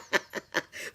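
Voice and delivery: chuckle, caught off guard. Real, not acted. [name] is laughing at me.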